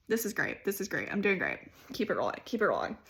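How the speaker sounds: quiet aside to steady herself mid-speech; muttered self pep talk. Real, not acted. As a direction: talk yourself through the nerves quietly, half to yourself.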